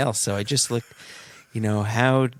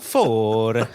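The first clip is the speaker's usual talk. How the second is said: confident, badly faked Italian accent; fake Italian accent; 4 dB louder than usual. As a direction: repeat it as a would-be Italian. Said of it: "say it in an Italian accent".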